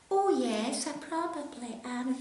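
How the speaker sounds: put-on high little girl's voice, shy; wee child's voice. Retelling the recording booth, a wee high voice. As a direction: imitate your tiny child self. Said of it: "This, this wee voice".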